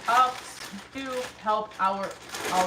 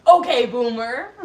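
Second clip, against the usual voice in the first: mock dismissive; 2 semitones higher, 17 dB louder than usual. Teasing older friend.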